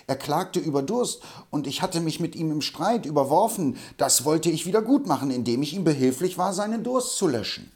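a hint of an aggrieved old pedant; lightly voiced Hobble Frank. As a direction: defend yourself like a touchy old cantor. Hobble Frank excuses himself.

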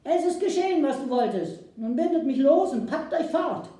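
high, loud call of the chief. Acted. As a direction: shout it down from the pueblo. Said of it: "Darum rief der Häuptling".

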